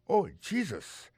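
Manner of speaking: slow, high drawn-out dismay; high slow oh Jesus; shocked exclamation. Shown as a demonstration. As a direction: stretch it out in shock. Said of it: "Ça veut dire".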